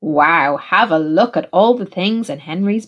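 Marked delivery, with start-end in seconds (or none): none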